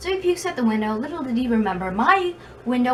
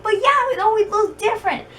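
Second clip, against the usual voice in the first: much higher and louder, whining; high whiny protest; 13 semitones higher, 3 dB louder than usual. Retold or imitated. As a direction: squeak it out like a defensive kid. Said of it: "I'm like".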